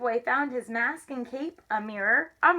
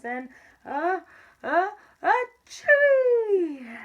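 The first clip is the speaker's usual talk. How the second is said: building mock sneeze, loud long finish; acted sneeze; 8 semitones higher, 7 dB louder, slower than usual. A narrator's reading. Spice tickles the kitten's nose, big acted sneeze. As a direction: wind up, then burst into a drawn-out pretend "achoo". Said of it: "The kitty boy sneezed".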